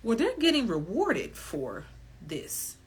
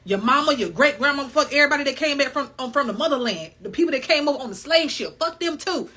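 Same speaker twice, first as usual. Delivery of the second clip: louder, higher mock-furious ranting; mock perturbed rant; 5 semitones higher, 7 dB louder than usual. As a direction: ham up the rage, loud and high. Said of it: "I'm perturbed".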